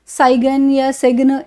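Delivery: "साइगन या सिग्न" with a hard G in both words; G sounded. Shown as a demonstration. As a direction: slowly misread "साइगन या सिग्न", voicing the G. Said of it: "इस तरह से मत बोलें".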